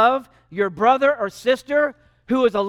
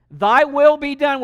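loud prayer; 5 semitones higher, 7 dB louder, slower than usual. Retold or imitated. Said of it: "How about".

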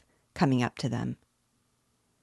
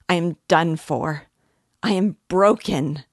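loud, despairing cry; vindictive despair; 8 dB louder than usual. A narrator's reading. Dying woman giving up, crying in vindictive despair. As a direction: cry it out bitterly, much louder. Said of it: "she cried with vindictive despair".

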